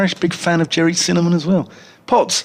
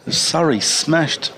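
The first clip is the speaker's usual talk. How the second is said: usual speaking voice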